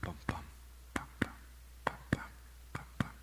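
heartbeat sounds made very softly; soft heartbeat imitation. Shown as a demonstration. Preacher imitating a heartbeat to soothe, very soft. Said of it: "there's something soothing about it".

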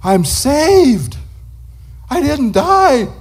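high, slow cry of joy; joyful relief. Retold or imitated. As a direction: let the relief burst out, climbing high. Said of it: "Lenny just said".

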